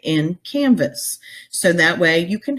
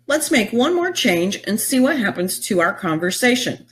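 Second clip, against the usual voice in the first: ordinary talk, nothing marked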